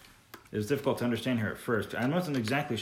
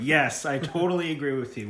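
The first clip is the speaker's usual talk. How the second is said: mock agreement; 5 semitones higher, 6 dB louder than usual. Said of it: "It's like".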